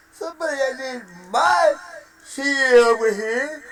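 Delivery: mock crying. Mock sobbing continues.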